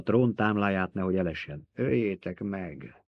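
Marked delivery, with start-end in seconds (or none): 1.7-3.0 mumbling. A narrator's reading. Old woman urging them to kill the child, mumbling. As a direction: mumble it weakly, drawn out. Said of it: "marmogta az öregasszony".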